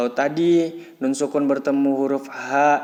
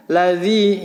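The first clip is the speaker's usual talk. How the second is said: "ladzi" with the final i held, louder; one-beat lengthening; 3 semitones higher, 5 dB louder than usual. Shown as a demonstration. Teacher models kasroh followed by ya sukun, long held vowel. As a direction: stretch the end of "ladzi". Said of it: "dibaca panjang satu ayunan".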